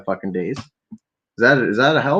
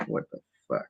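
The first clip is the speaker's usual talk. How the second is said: whispered what the fuck; 13 dB quieter than usual. Real, not acted. Startled aside.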